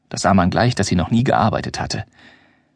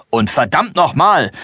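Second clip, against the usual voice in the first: slow, higher, cursing explosion; angry outburst; 5 semitones higher, slower than usual. Acted. The Venetian shouts at the table over his bitten bread, angry. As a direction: bite down on the curse. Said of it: "ruft der Venezianer".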